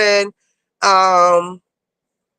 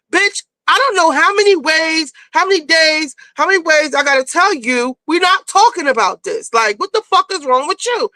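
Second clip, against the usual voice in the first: higher-pitched retold snap; snapping; 5 semitones higher than usual. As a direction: act out losing patience with her, emphatically. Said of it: "And she snaps on Brandy".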